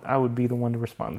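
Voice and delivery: usual speaking voice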